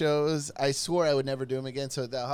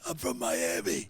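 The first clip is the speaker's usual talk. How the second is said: put-on low, slow Brooklyn tough-kid voice; thick Brooklyn accent; 9 semitones lower, 3 dB quieter than usual. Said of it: "he had a voice like this".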